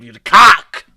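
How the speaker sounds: the cock shouted loud; loud teasing shout; loud comic jab. Real, not acted. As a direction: throw it at him as a yell. Heckling the video creator.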